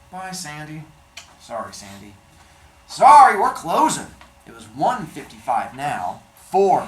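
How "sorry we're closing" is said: curt clerk. Acted. Clerk hanging up.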